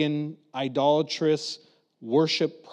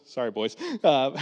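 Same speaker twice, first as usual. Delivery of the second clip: ordinary talk, nothing marked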